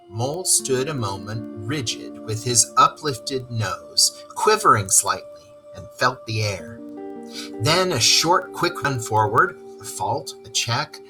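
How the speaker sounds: plain reading voice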